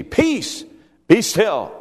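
high, ringing command. A narrator's reading. Reading Jesus' command to the sea.

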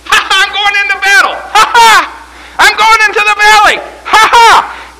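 very high, loud war-horse cry; exultant, blaring mock horse voice; horse laugh. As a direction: laugh and holler like a horse charging into battle. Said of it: "it's a horse laugh".

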